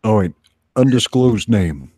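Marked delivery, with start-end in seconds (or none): none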